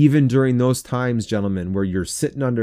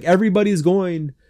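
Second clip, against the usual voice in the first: higher-pitched mock kid complaint; exaggerating kid; 7 semitones higher than usual. Kid pleading with a parent, exaggerated whine imitated. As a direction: imitate a kid overstating the case. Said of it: "it's just that exaggeration".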